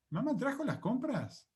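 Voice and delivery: "¿Mamá trajo las compras?" pitched high as a question, swooping up on each word; rising question intonation. Shown as a demonstration. Teacher turns the statement into a question, rising intonation.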